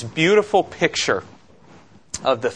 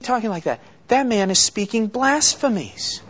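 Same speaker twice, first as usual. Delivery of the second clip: scandalized scribes; 9 semitones higher than usual. Acted. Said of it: "They're sitting there thinking".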